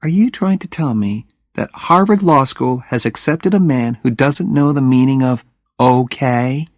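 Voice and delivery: Jenny needling him with a smirk; teasing Jenny. Acted.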